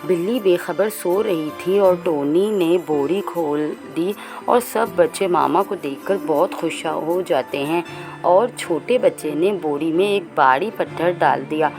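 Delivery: ordinary delivery, nothing marked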